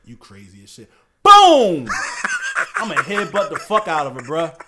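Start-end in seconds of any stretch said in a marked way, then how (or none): 0.0-0.9 quiet menace. Retold or imitated. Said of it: "I said". 1.2-1.9 sudden loud high BOOM; loud boom shout. Retold or imitated. Acting out a headbutt.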